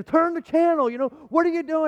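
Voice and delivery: high, loud mock nagging; scolding wife. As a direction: imitate an alarmed wife snapping an order. Wife scolds him over television.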